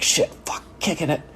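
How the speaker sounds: whispered panicked curse from the kicker; nervous kicker whisper. Retold or imitated. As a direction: breathe out the curses under your breath, nervous. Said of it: "He's like".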